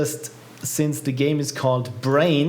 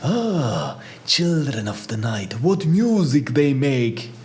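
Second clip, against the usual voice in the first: vampire voice; slower than usual. Pretend. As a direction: intone it like a Transylvanian count. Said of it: "cool vampire-like voice".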